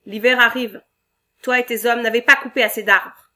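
loud, demanding boss barking orders; bossy shout. Acted. Boss scolding the lumberjack chief.

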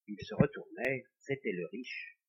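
usual speaking voice

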